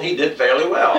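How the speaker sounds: ordinary delivery, nothing marked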